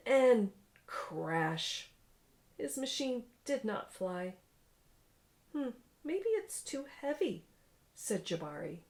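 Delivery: lively read-aloud storytelling voice; story narration. A narrator's reading. Parent reading a picture book to a child, animated narration.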